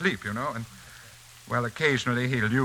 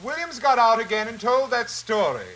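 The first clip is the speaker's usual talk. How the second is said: high, louder call up the stairs; 11 semitones higher, 4 dB louder than usual. Acted. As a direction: shout up to her to come down. Calling wife from downstairs.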